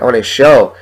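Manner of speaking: normal talking voice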